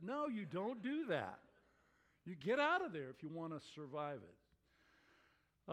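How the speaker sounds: usual speaking voice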